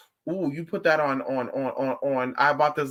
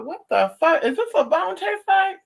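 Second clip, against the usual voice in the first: high, loud mock outrage; disgusted shock; 14 semitones higher, 4 dB louder than usual. Retelling her shock at the bone taste, high and loud. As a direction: squawk it like the taste just hit you.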